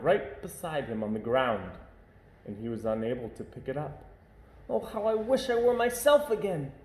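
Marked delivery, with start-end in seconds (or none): 4.7-6.7 put-on high, pleading young voice; a child donkey longing to be himself; wistful young donkey. A narrator's reading. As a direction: wish it with all your heart, young and high. Sylvester's wish voiced.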